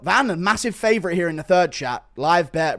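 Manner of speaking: ordinary delivery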